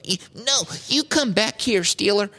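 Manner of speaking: put-on high-pitched old man's indignant call; old man yelling. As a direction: yelp it like an old man chasing a thief.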